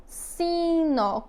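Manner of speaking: "Sino" drawn out as si, nó; two long beats on "Sino", ending in open nó; syllable by syllable. Shown as a demonstration. Teacher asking pupils to count, "Sino" split syllable by syllable. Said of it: "Quantas vezes eu abri minha boca?".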